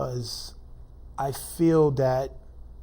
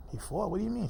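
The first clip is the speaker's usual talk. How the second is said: high, questioning, not raised in volume; retold confusion; 7 semitones higher, 5 dB quieter, faster than usual. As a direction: relive not grasping the news, pitch climbing. Retells hearing of son's death.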